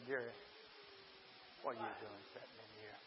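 very low, hushed voice of God; quiet, low inner voice; small still voice. Retold or imitated. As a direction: drop right down to a soft, gentle prompting. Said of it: "And that small, still voice said".

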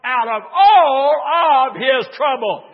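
loud, drawn-out preacher's call; preaching. Acted. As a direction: preach it out big. Preaching at full force.

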